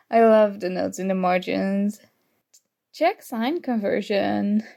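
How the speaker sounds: ordinary delivery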